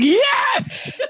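high, triumphant yes; gleeful yes to mom. Retold or imitated. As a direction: crow it with delight. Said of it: "I was like".

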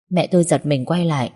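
usual speaking voice

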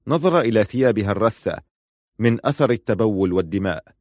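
ordinary delivery, nothing marked

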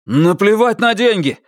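high, urgent exclamation; exclaiming. A narrator's reading. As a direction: burst out, pitch jumping up. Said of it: "воскликнул Джонни".

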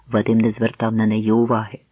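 usual speaking voice

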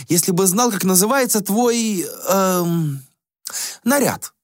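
put-on high, airy voice of a vain young prince; a high, languid young prince; prince Axius voice. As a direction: play the bored, spoiled prince. Prince character voice.

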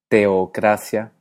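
TEOCRACIA slowly, E and O apart; hiatus. Shown as a demonstration. Teacher asks which you say, teocracia in slow hiatus. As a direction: say te-o-cracia with separate vowels. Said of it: "Tiendes a mantenerlas separadas".

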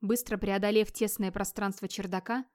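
ordinary delivery

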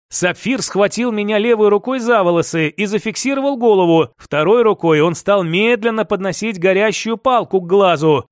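ordinary delivery

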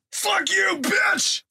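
very high strained mock shout. Gamer faking a man abusing his sister, shouting. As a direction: shout it as the angry intruder.

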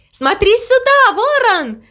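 high, excited shout; calling out. A narrator's reading. Mama Mu calls the crow to watch, shouting high. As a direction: call a friend over, pitch way up. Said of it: "крикнула она".

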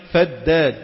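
فداد said slowly, first د held long and doubled; doubled dal. Shown as a demonstration. Teacher spelling out the word for listeners, doubled د in فداد. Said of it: "بتشديد الدال".

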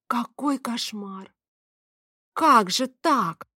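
slow, high, dismayed wail; mortified. A narrator's reading. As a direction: lament the mistake, drawing words out. Mortified at her mistake.

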